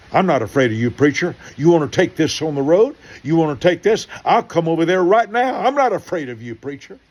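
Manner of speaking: high, loud, taunting challenge; mock tough-guy threat; threatening contractor. Contractor daring the pastor to fight, loud and taunting.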